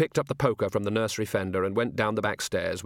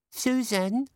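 put-on high small child calling; a small child at the door; little girl voice; 13 semitones higher than usual. Little girl's voice.

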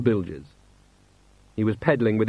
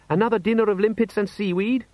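put-on higher boy voice, whining; young son complaining; 10 semitones higher than usual. A narrator's reading. Son Les whining to his father about dinner, higher pitched.

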